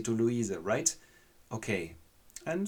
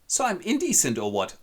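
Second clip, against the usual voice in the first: offended snap; 8 semitones higher, 6 dB louder than usual. Acted. Lady takes offence.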